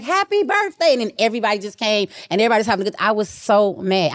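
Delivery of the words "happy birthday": mock cheer. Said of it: "It was like".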